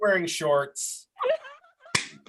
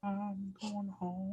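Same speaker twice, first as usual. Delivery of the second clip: very quiet slow mumble; 3 semitones higher, 15 dB quieter, much slower than usual. Acted. Dazed character leaves mid-murder.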